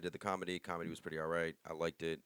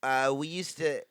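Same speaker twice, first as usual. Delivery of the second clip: slow, higher mock lady comic; lady comedian; 5 semitones higher, slower than usual. Mocking a lady's pom-pom act, lady impression. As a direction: drag it out, pitched up, imitating her act. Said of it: "the lady was just like".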